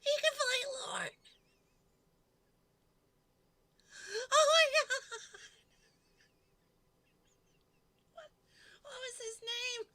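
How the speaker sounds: high, loud, rushed excitement; excited, frantic. Real, not acted. Streamer tries to recall an actor's name, high and frantic. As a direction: squeal it as the name escapes you.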